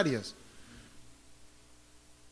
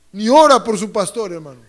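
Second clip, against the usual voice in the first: usual speaking voice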